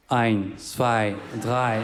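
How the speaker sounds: put-on German count-in, lightly accented. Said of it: "je change d'accent". (compte en allemand, accent allemand léger)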